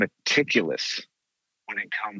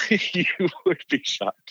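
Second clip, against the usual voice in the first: laughing; 9 semitones higher, slower than usual. Real, not acted.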